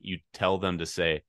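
normal talking voice

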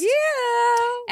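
high cheering yeah; excited yeah. Real, not acted. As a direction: cheer the big news. Cheering the news.

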